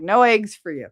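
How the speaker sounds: put-on defiant protest; mock protest. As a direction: refuse loudly as a protesting hen. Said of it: "they're protesting. They're like".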